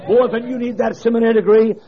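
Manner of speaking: fiery sermon climax; preaching at full force. Acted. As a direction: drive the point home to the crowd.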